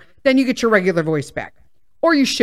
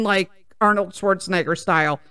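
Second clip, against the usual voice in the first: usual speaking voice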